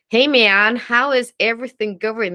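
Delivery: loud attempt at American; overdone mock American greeting; bad American accent. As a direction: imitate an American, badly. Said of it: "And I try American".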